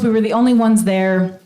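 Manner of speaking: usual speaking voice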